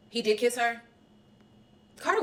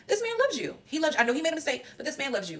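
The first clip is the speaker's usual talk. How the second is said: retold insistence; 8 semitones higher than usual. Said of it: "Liam told her".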